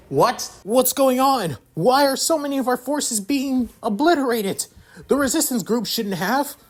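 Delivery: high, rising panic as the troops fall; a colonel who can't believe what he sees; alarmed colonel. Acted. Colonel watching his forces vanish, high alarmed voice.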